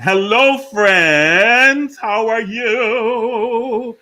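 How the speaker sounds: high, loud, drawn-out hello; big sung-out welcome; excited greeting. Real, not acted. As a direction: greet the room like a showman. Host greets guests with flourish.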